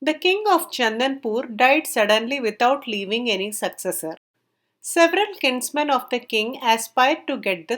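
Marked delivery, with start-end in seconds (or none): none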